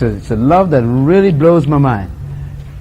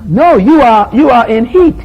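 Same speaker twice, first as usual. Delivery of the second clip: louder, higher correction; punchy loud comeback; emphatic retort; 7 semitones higher, 5 dB louder than usual. Real, not acted. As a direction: correct them forcefully. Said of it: "preacher retorts emphatically".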